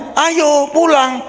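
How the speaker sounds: high, slow mother's call to come home. Retold or imitated. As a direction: call the child like a mom, drawn out. Mother calls child home. (ibu memanggil anak, tinggi dan pelan)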